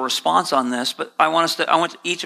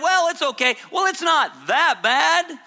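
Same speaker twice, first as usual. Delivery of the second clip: complacent Christians; 8 semitones higher than usual. Retold or imitated. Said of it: "we as Christians have decided".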